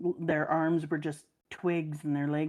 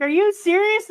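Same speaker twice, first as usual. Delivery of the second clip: mock shocked squeal, much higher and louder; shocked disbelief; 13 semitones higher, 8 dB louder than usual. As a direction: play the horrified kid, voice jumping way up. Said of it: "you'd be like".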